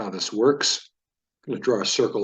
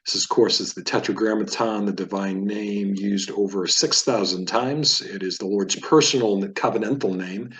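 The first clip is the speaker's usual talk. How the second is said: normal talking voice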